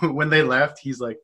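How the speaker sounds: normal talking voice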